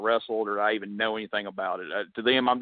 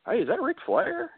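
high, bright-eyed "is that him" question; sudden starstruck excitement. Retold or imitated. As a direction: flip from fury to fan delight. Angry customer spots the picture.

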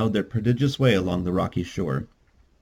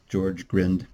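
ordinary delivery, nothing marked

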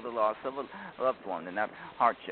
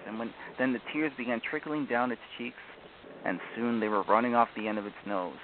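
ordinary talk, nothing marked

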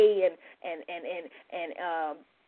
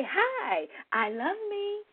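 cheerful greeting; 10 semitones higher, 5 dB quieter, slower than usual. Shown as a demonstration. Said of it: "So just say".

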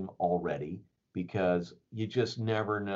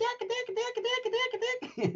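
very high, squawky canary chirps; squawking bird; slower than usual. Retold or imitated. The canary squawking over the scene, very high mock chirps.

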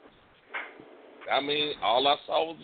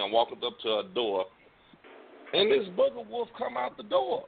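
usual speaking voice

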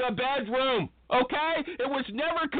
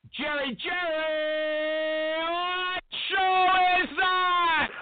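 slow, high drawn-out shout; calling out; 7 semitones higher, much slower than usual. Acted. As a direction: call him like a game-show host. Host calls out to sidekick.

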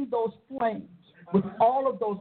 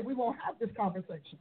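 ordinary talk, nothing marked

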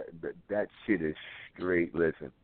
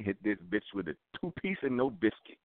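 normal talking voice

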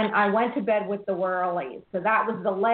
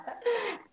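bright laugh, high and airy; giggling thanks; high delighted laugh; 12 semitones higher, 6 dB quieter than usual. Real, not acted.

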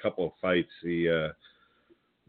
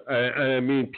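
usual speaking voice

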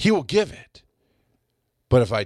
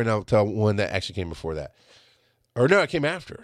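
ordinary delivery

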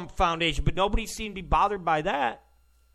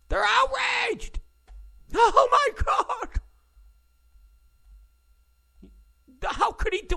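mock outrage. Host mimics liberals shrieking over Comey, high mock hysteria. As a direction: squeal "outraged" like a hysterical lib.

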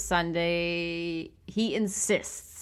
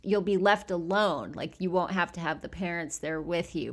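normal talking voice

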